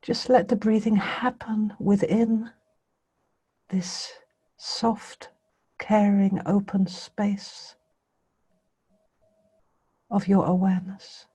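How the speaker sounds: meditation guidance in spaced phrases, long pauses; guided meditation. Real, not acted. Teacher bringing listeners' attention gently to the breathing, guided meditation. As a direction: leave silence between phrases as you guide.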